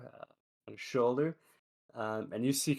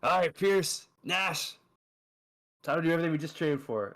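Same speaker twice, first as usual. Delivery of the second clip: put-on drunk goblin rallying; 6 semitones higher, 3 dB louder, faster than usual. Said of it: "He says".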